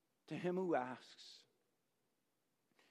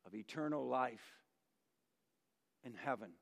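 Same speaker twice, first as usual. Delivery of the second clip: solemn hush; 4 semitones lower, 6 dB quieter than usual. Acted. Solemn sacrifice, voice drops.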